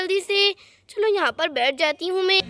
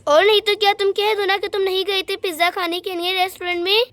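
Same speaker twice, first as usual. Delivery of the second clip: usual speaking voice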